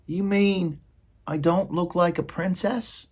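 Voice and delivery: high, near tears; hurt high maid voice. Acted. Meg asking the rat, high and hurt. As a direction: voice of a girl fighting back tears.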